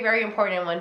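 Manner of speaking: usual speaking voice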